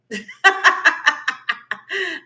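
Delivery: long, loud, high laugh; real rolling cackle. Real, not acted. Host laughs at own remark.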